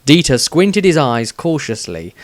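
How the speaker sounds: ordinary delivery, nothing marked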